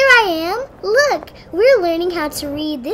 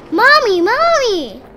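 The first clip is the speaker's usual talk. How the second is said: loud, higher happy call; calling for mom; 5 semitones higher, 6 dB louder, slower than usual. Acted. Little sister running home to mother, loud excited call. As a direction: shout for mommy across the room.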